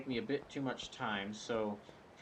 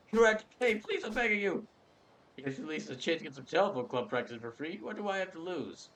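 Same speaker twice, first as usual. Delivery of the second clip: louder, higher desperate plea; higher loud pleading clerk; begging clerk; 7 semitones higher, 4 dB louder than usual. Acted. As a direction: plead with the customer. Clerk begs loudly.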